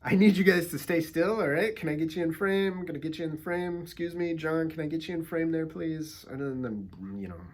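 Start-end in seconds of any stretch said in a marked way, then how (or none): 0.0-6.2 raised, higher voice herding people into frame; loud call to pose. Retold or imitated. Photographer to restaurant guests, loud and high calling out. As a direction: call out to the group to hold still.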